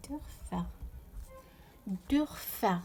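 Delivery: دورفر with an umlauted first vowel. Shown as a demonstration. Teacher models the German example word, دورفر with ö. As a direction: say دورفر with rounded front ö, as in Dörfer. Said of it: "كي زدت له الاملاوت".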